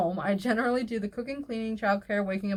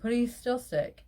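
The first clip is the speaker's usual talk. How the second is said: ordinary talk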